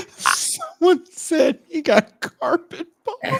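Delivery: high, slow words squeezed through real laughter; helpless laughter. Real, not acted. Host relaying a joke about the story, cracking up. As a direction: barely get it out for laughing.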